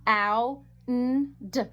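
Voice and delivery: the word "hound" broken into separate sounds "ow, n, d"; sounded out. Shown as a demonstration. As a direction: say "ow", then "n", then "d", each on its own. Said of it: "I hear ow".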